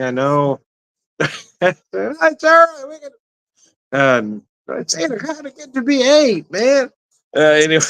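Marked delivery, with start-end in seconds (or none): 1.6-3.1 hammed-up squeaky voice, very high and drawn out, slow; mock high voice. Retelling the dinner that ran late, in a mock high voice. 4.8-6.9 hammed-up squeaky surprise, much higher; mock high voice. Realising it's after eight, mock high squeal. Retelling a late-night exchange.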